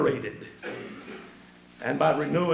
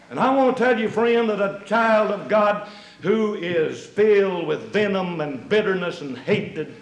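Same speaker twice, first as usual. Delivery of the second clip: full-force preaching; 3 semitones higher, 5 dB louder than usual. Acted.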